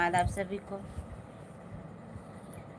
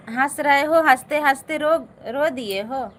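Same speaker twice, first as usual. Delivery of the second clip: teasing laugh-cry; 5 semitones higher, 9 dB louder than usual. Real, not acted. Teasing a viewer who laughed-cried.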